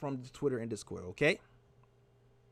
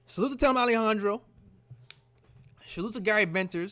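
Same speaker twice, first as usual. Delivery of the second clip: hyped shout-out; 5 semitones higher, 5 dB louder than usual. Real, not acted.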